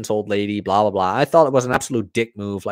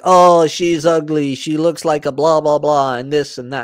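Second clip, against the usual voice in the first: higher, louder mocking troll voice; scornful quoting of online trolls; mock troll comment; 3 semitones higher, 4 dB louder than usual. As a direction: sneer it like a nasty commenter. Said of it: "the comments were just like".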